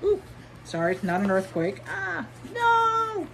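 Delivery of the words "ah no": startled cry. Real, not acted. Startled by shaking.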